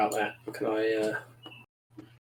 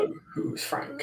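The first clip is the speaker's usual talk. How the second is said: mock kobold whisper; 5 dB quieter, slower than usual. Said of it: "It's like".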